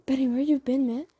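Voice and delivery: put-on half-hearted rough kid voice; Smalls voice, first try. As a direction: call out to Benny, annoyed. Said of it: "He's like".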